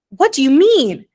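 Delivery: loud, high shocked cry; aghast exclaim. A narrator's reading. Homily hearing the boy took the cup, aghast. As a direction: blurt it out aghast. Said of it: "exclaimed Homily, aghast".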